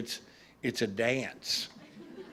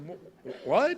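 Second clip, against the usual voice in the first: shocked what; 6 semitones higher, slower than usual. Retold or imitated. Stunned reply to trainer's insult.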